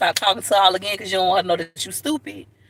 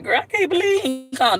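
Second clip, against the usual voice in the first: mock whine; 9 semitones higher than usual. Crying to friend on phone.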